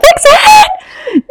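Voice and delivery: high, frantic mock yell; hammed-up shout at bakery staff. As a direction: bark it like the frantic Pivot guy. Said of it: "Like, that was me".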